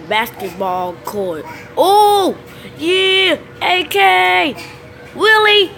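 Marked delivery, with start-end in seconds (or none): 1.7-5.7 high loud hype; excited shout. Real, not acted. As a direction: call out in triumph. Excited calling out in game.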